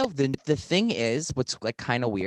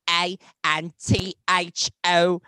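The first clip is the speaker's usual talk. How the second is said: slow spelling; 3 semitones higher, 9 dB louder, slower than usual. Acted. Slowly spelling out Instagram handle.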